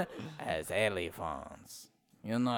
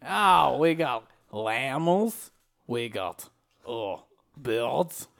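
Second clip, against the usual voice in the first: a hammed-up French zookeeper accent; lightly put-on French accent; mock French accent. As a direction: play a silly French zookeeper. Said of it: "you sound more and more Russian".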